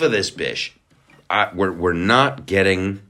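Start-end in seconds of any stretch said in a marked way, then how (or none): none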